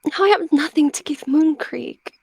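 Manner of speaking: high-pitched plaintive whine; whining. A narrator's reading. She-cat lamenting she has nothing for Moon Creek, whining. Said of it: "she whined".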